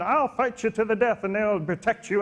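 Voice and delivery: high-pitched, hammed-up eager protector; Peter. Peter vows to defend Jesus to the death, high hammed-up bravado. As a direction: imitate an overexcited follower swearing loyalty, voice pushed high. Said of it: "His voice actually gets like that".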